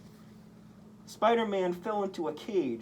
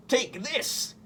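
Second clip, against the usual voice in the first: much higher villain shout; Goblin yell; 10 semitones higher than usual. A narrator's reading. Goblin throwing pumpkin bombs at Spider-Man, high villain yell. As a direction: hurl the words with the bombs. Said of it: "the Green Goblin yelled".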